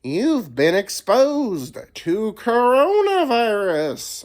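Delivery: drawn-out mock phone alert; robotic alert voice. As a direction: read it like an automated warning. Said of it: "It's like".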